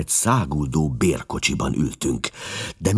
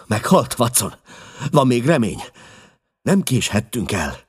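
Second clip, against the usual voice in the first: anxious pleading; 8 semitones higher, 4 dB louder than usual. A narrator's reading. Holmes fears the lady is dead.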